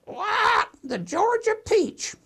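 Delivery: very high, drawn-out parrot squawk; squawk, then slowly screech out the nickname; squawking bird. Acted. Bird answering the store owner, squawky parrot voice. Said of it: "And the bird says".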